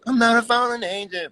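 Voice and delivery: high radio morning voice, sung; morning show voice. Shown as a demonstration. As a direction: belt it like a morning radio singer. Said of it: "Morning voice".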